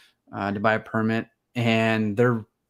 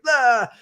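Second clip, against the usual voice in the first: high, loud startled "ah"; panicked yelp; 11 semitones higher, 6 dB louder than usual. Retold or imitated. Photographer panicking during the hour with gorillas, high yelp. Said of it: "you're just freaking out".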